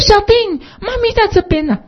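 put-on high-pitched call of a mother looking for her child. Mother calling her lost son across the airport, high voice. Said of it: "他说".

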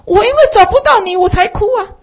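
put-on high, fast child's voice; child answering. Boy answering his parents, high and hurried. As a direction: blurt it out like a small boy. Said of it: "孩子他回父母亲的话".

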